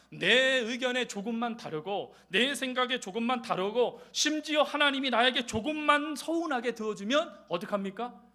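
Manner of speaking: usual speaking voice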